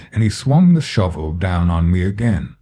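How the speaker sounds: ordinary delivery, nothing marked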